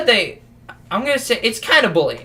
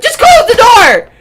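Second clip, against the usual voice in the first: high, loud desperate yell; imitated panicked kid; 11 dB louder than usual. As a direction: beg loudly and high in panic. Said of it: "he's like".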